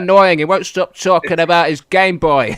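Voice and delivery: loud, high tannoy announcement; shop announcer. Retold or imitated. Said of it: "the Tesco's announcer over the tannoy".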